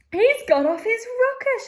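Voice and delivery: high, loud horrified shout; aghast shout. Acted. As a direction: cry out in shock at the madman. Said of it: "shouted one of the fathers aghast".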